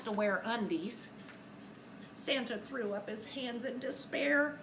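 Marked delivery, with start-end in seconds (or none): none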